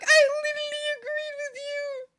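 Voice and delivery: mock squeaky objection; voicing Frog. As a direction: protest in a high-pitched character whine. Voices Frog's reply.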